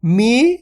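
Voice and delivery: मी held long, rising at the end; teacher's sustained मी; drawn-out long vowel. Shown as a demonstration. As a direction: stretch the ee in मी. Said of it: "खींच के पढ़ेंगे अपन इसको".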